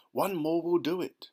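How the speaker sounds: eager shout. Acted. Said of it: "shouted the old green grasshopper".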